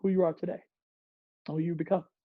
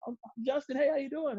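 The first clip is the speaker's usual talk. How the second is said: higher friendly intro; eager intro; 7 semitones higher than usual. Retold or imitated. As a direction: hand out the greeting brightly. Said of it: "you know".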